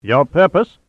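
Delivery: haughty German official's challenge; superior Teutonic demeanor. A narrator's reading. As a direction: try to intimidate with superiority. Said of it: "attempted to intimidate Pitt with a superior Teutonic demeanor".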